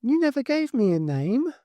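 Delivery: put-on a dead girl speaking through the boy; eerie girl's voice. A narrator's reading.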